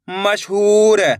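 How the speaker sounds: syllables joined into one slow word; blended word. Shown as a demonstration. Teacher joining the syllables for learners, slowly blended. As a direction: read it as one whole, stretched word. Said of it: "तो मिलके बन जाएगा".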